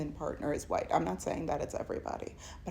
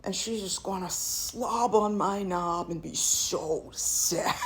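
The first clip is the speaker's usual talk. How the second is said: scheming man. Retold or imitated. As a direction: gloat about his plan, mocking. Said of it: "and go".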